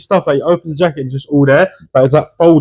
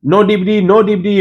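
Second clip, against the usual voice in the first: higher-pitched, complaining Chinese-accent impression; imitated Chinese-accented DVD seller; mock Chinese accent; 6 semitones higher than usual. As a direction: protest in a put-on Chinese accent. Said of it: "like this, it was Chinese accent".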